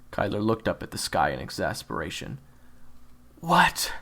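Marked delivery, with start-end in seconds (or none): none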